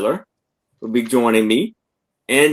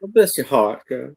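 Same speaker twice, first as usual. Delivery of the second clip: mock Southern accent; 3 dB quieter than usual. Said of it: "there are sometimes movies from the South".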